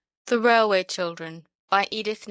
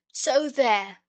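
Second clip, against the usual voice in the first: mock triumphant, a touch louder; triumphant so there; 6 semitones higher, 4 dB louder than usual. A narrator's reading. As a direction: crow it with exasperated triumph. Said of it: "in a tone of exasperated triumph".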